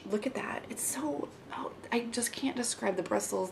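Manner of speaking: breathless awed whisper. Real, not acted. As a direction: whisper it in awe. Admiring new liner brush.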